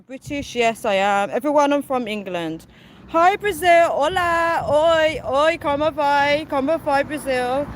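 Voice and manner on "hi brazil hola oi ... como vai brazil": excited greeting. Real, not acted. Greeting Brazilian viewers in chat.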